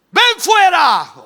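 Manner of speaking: high, drawn-out shouted command; commanding slow shout; great loud call. Acted. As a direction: summon him out at full volume. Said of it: "llamó a gran voz".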